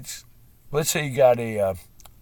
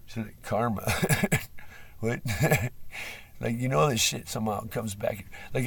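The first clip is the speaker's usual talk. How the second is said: ordinary delivery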